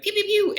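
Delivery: high toy-gun pew pew; gun sound effects. Retold or imitated. Imitating gunfire in an engagement.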